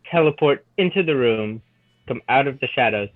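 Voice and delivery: normal talking voice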